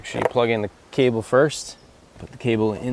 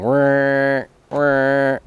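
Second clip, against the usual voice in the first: louder imitated motor whir; whirring robot servos; 5 semitones higher, 7 dB louder than usual. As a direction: mimic the grinding motor. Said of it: "before it was going".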